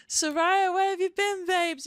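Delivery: high-pitched, loud gush; excited greeting. Real, not acted. Streamer asking Soraya where she has been, high excited gush. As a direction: call out high and loud to a long-missed friend. Greets a returning viewer again.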